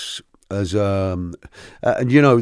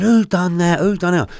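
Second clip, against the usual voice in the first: retold angry demand; 9 semitones higher, 4 dB louder than usual. Big fella demands who threw burger.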